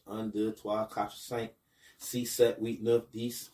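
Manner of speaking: un, deux, trois, counted in schoolbook French; counting in French. Shown as a demonstration. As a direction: count to ten in French like a classroom drill. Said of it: "learn how to count to ten in French".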